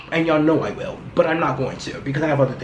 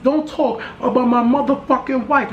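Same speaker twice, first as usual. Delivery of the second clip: much higher, heated retelling of a warning; high-pitched, imitated outburst defending his wife; mock angry retort; 8 semitones higher than usual. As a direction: play the husband snapping back on stage. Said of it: "He said".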